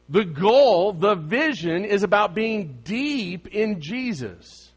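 high, emphatic preacher voice; impassioned pulpit delivery; forceful preaching. Acted. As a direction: preach it with rising force, leaning hard on key words.

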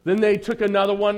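ordinary delivery

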